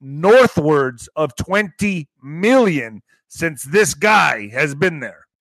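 slow, every word weighed; slow emphatic repeat. Shown as a demonstration. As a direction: drag it out so it sinks in. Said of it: "Let me just say that again".

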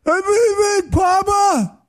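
lunatic screaming. Retold or imitated. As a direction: bellow "Papa" like a raving man. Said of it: "He's screaming like a lunatic again".

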